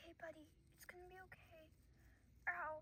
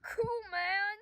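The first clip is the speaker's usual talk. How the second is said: shout; 6 semitones higher, 18 dB louder than usual. Acted.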